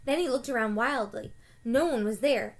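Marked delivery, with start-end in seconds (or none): none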